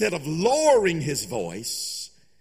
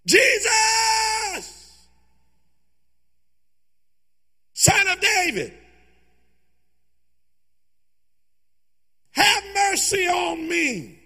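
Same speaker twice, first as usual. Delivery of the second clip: high, loud, drawn-out cry; shouting; 12 semitones higher, 4 dB louder, much slower than usual. Acted. Beggar crying out all the more to Jesus, shouting. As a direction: shout over everyone who hushes. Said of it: "Bartimaeus wouldn't stop yelling".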